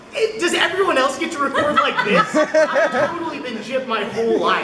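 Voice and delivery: high-pitched, amused mock complaint; mock indignation. Actor complaining about the recording setup, mock-indignant. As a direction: play aggrieved, laughing through it.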